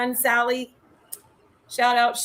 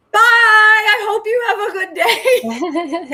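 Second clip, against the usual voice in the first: much higher, louder, slow, laughing sing-song; sweet goodbye to otter; 12 semitones higher, 10 dB louder, much slower than usual. Retold or imitated. Saying bye to the little otter, much higher, slow, laughing. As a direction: coo a farewell to a cute animal.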